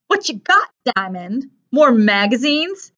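high, drawn-out mocking question; sneering singsong taunt; bully's taunt. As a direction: tease him like a schoolyard bully. Bully grabs the backpack, teasing.

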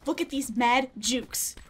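ordinary talk, nothing marked

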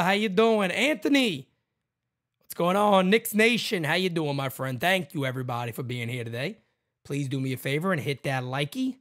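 ordinary talk

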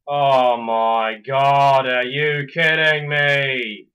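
loud, slow, drawn-out disbelief; exasperated outburst. Real, not acted. Gamer reacting to a bad turn in the game, loudly exasperated. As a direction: stretch each word out in real frustration.

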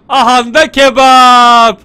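loud, very drawn-out announcement; triumphant reveal. Real, not acted. Gamer revealing the kebab item to viewers, triumphant drawn-out call. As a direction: unveil it proudly, holding the vowels long.

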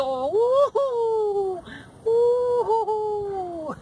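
high whoop of celebration; joyful whoop. Real, not acted. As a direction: let out a happy whoop. Cheering at the video's end.

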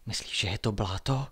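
hushed, wondering question; softly voiced puzzlement, lightly acted; half-whispered wonder; amazed whisper. A narrator's reading. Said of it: "šeptal udiveně Martin".

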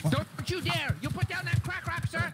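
high, frantic police order; alarmed command. Acted. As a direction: order it dropped in rising alarm. Officer orders man to drop contraband.

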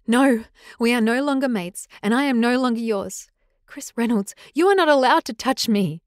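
defiant, rising anger; firm rebuke. Acted. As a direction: set him straight, building. Rosalie rejects him firmly.

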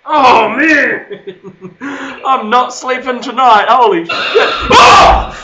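high, loud frightened cries; scared yelling. Real, not acted. Spooked player yells he will not sleep tonight. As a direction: panic and laugh.

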